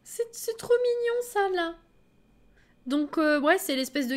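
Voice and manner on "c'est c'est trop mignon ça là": very high, slow squeal of delight; gushing. Real, not acted. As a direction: melt over something adorable. Overwhelmed by cute scene. (s'extasier d'une voix aiguë)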